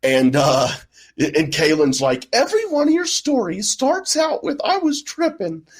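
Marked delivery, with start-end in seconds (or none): 2.3-5.7 high-pitched mimicry without the accent; high mocking Kaylin voice. Friend teasing his tripping stories, high mock voice. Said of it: "I didn't do the access".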